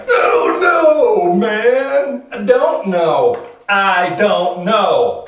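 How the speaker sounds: loud, high, slow howling; exasperated wail. Acted. Man answers the congratulating village, wailing in exasperation. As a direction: wail it out in mock despair.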